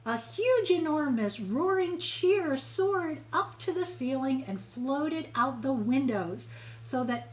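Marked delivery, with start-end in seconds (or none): none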